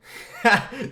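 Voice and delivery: high loud laugh; real laugh; 1 burst of laughter. Real, not acted. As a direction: crack up at the slip. Laughing at own mistake.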